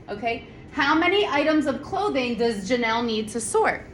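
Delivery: ordinary talk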